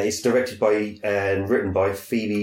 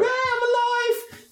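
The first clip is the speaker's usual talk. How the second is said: very high, slow squeal; mock reveal; slower than usual. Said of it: "she's gonna go".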